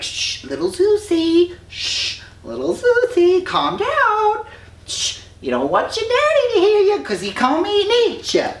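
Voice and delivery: put-on very high cooing hush to a baby; cooing nymphs. Nymphs hushing crying baby Zeus, very high cooing voice.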